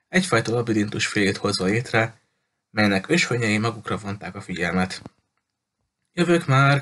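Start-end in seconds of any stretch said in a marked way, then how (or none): 6.1-6.8 higher, louder call from the back of the shop. A narrator's reading. Shop owner calling out to arriving customers, higher and louder. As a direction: call out cheerfully to the door. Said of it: "kiáltotta a tulajdonos".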